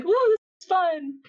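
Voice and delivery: playfully mock her delight while spinning; a girl being spun fast, thrilled; excited girl. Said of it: "she's like".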